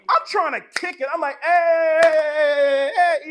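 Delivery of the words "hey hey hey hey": loud, sustained "hey"; loud celebratory whoop; exuberant party cheer. Acted. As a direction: holler it out like you're having fun. Acting out kicking it in worship.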